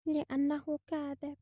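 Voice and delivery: slow, soft, high bird voice; drawn-out, quiet childlike insistence; primitive speech. A narrator's reading. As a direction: repeat it slowly and simply. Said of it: "للطريقة البدائية التي يتكلم بها".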